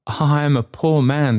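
high, hesitant character voice; meek, high-pitched admission; faltering. A narrator's reading. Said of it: "faltered Trotty".

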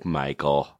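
usual speaking voice

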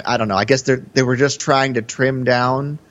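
ordinary delivery

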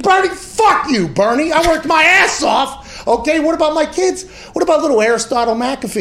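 mock outraged shout at a politician; mock angry yell. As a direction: yell it at him like he robbed you. Ranting at Bernie about taxes.

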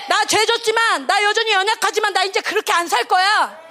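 high, forceful preacher's declaration; impassioned acted determination; fervent resolve. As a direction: declare a vow with rising fervour. Voicing Phinehas's resolve to God.

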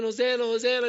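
loud, high Hosanna shout of celebration; joyful crowd cheer. Retold or imitated. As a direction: cheer it like a crowd greeting a king. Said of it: "People are saying".

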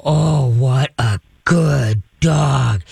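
slow, drawn-out ominous praise; low ticked tone. Shown as a demonstration. Owner praises dog in angry pitch, low ticked tone. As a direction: stretch each word in a deep, displeased tone. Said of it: "The low tones, you're ticked".

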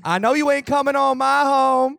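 high, slow Southern challenge; mock Southern accent. Southerner defending home from Putin, mock Southern drawl. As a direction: drawl it out, indignant and hammed up. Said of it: "Joe was talking about Putin invading Georgia".

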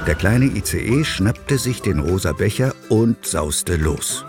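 usual speaking voice

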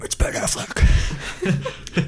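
slow, mocking raspy whisper. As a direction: rasp it out slowly with a laugh. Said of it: "Not what I was going for".

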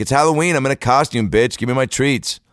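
high, insistent mock demand; entitled kid. Kid demanding candy at door, high entitled insistence. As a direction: imitate a bratty trick-or-treater. Entitled kid's thought, acted.

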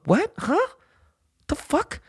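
baffled and mocking, voice shooting way up; high-pitched what-the-fuck disbelief; incredulous. Real, not acted. Reacting to quoted groupie claims.

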